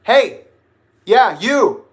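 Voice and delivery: loud, high challenge across a room; confrontational yell. Retold or imitated. Confronting the man looking straight at them, yelling. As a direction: yell to single someone out. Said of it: "And I go".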